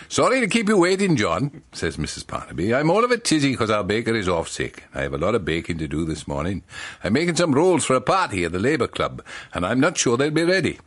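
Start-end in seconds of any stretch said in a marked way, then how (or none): 0.1-1.6 lightly done Welsh baker's wife; a hint of a Welsh lilt, pitched up; Welsh accent. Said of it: "Missus Barnaby's from Wales". 2.7-10.8 lightly done Welsh baker's wife; a hint of a Welsh lilt, pitched up; Welsh accent. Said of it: "Missus Barnaby's from Wales".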